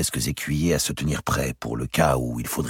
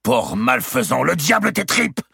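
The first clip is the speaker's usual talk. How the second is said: Bourbon's insult; 10 semitones higher, 4 dB louder than usual. A narrator's reading. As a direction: hurl the insult at Robert. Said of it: "lança Louis de Bourbon à Robert".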